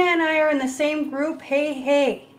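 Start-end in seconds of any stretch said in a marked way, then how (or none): none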